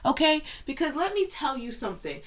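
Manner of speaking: loud, high indignant telling-off; indignant scolding. Real, not acted. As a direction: put your foot down. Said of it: "scolding the movie".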